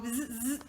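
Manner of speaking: mock zzz welding noise; imitated welder hum with the lips; welding buzz. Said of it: "instead of saying weld".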